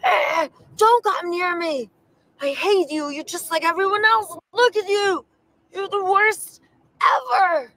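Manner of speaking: hammed-up crying rage; tearful mock outburst. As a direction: play the hurt one shoving help away. Pretend upset person lashing out.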